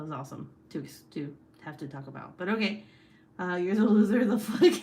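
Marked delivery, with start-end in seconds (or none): 3.4-4.7 loud teasing through laughter; laughing taunt. Real, not acted. Streamer teasing her sister back, loud laughing taunt. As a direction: fire it back while laughing.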